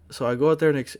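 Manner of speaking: ordinary talk, nothing marked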